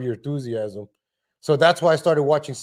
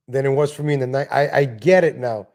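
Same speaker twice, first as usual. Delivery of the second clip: normal talking voice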